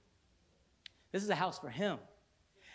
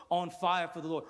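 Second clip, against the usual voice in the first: ordinary talk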